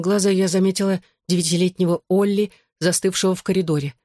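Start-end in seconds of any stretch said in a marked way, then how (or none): none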